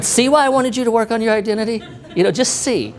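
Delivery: high-pitched, lightly teasing voice of God; smiling God, imitated. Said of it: "God's just smiling, going".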